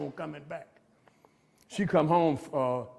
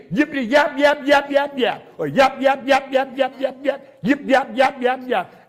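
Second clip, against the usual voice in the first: higher, louder mock chatter, yap yap; 6 semitones higher, 4 dB louder than usual. To the recovery group, mocking a chatterbox in a higher, louder voice. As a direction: mimic someone who won't stop talking.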